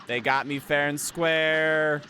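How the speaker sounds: mock concession. Arguing with himself, fair or not.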